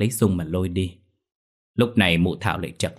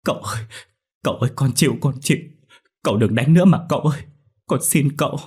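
higher, louder sobbing surrender; wailing plea; 5 semitones higher, 3 dB louder, faster than usual. Acted. Girl clutches his leg, wailing in surrender. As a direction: give in through tears. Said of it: "rồi gào khóc".

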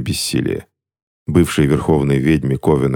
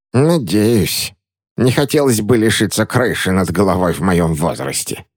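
grumbling; 5 semitones higher than usual. A narrator's reading. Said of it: "проворчала София".

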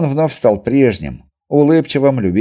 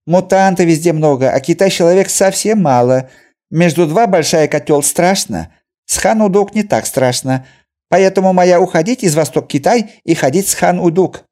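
Chinese merchant voice; 9 semitones higher, 5 dB louder than usual. A narrator's reading. Merchant's grim account.

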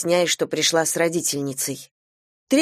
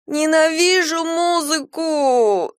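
high, slow wailing through angry tears; 11 semitones higher, much slower than usual. A narrator's reading. Girl wailing to her family about music lessons, tearful wail. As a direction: wail it out like a sulking child. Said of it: "завывала я".

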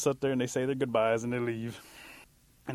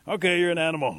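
loud, higher-pitched verdict; hearty acceptance; 6 semitones higher, 8 dB louder than usual. Acted. Bear to the little creature, accepting it as an animal, hearty and loud. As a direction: announce the decision heartily.

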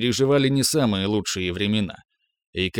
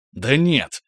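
shouted; 3 semitones higher than usual. Acted. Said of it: "выкрикнул Росс".

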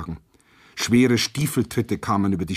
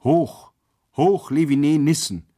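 raised, higher rallying cry; crowd cheer; 5 semitones higher, 4 dB louder than usual. A narrator's reading. Prisoners cheer from the middle of the cell, shouting. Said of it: "schrien etliche aus der Mitte".

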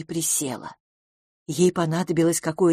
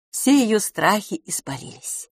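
usual speaking voice

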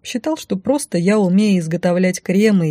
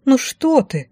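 offended; 7 semitones higher, slower than usual. A narrator's reading. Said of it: "оскорбилась я".

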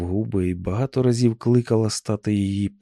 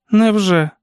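put-on high, louder young woman's character voice; niece's brief retort; 9 semitones higher, 5 dB louder than usual. A narrator's reading. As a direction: answer the aunt in one high, falling word. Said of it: "тільки й сказала Сюзан". (високий голос племінниці, коротка відповідь)

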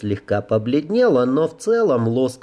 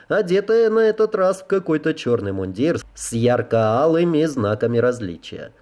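usual speaking voice

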